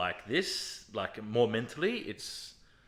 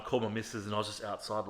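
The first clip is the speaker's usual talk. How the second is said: ordinary talk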